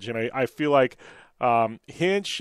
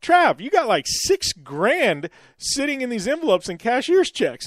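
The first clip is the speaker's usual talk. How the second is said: excited disbelief; 8 semitones higher than usual. Retold or imitated. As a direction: push it up with amazement. Texting Trav about the money.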